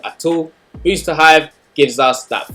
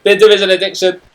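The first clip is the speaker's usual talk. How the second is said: loud, high Jamaican English sign-off; 5 semitones higher, 6 dB louder than usual. Shown as a demonstration. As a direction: shout the outro in Jamaican English. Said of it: "my Jamaican accent".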